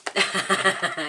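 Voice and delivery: a loud, rueful burst of laughter; embarrassed laugh. Real, not acted. Laughing at her own mistake on camera: loud embarrassed laugh. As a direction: laugh it off at yourself.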